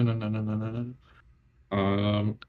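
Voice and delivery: ordinary talk, nothing marked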